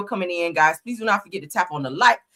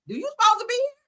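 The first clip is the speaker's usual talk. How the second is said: mocking question; 13 semitones higher, faster than usual. Real, not acted. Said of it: "I hear a little thick accent".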